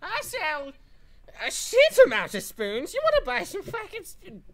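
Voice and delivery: put-on high, loud old shopkeeper voice; cranky old spoon seller; old man voice. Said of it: "my Rule's card voice is my old man voice".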